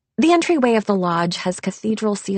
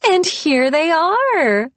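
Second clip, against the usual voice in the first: high, slow, delighted hostess; excited greeting; 10 semitones higher, 6 dB louder, slower than usual. Acted. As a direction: sing it out in welcome, higher and louder. Hostess greets arriving guests.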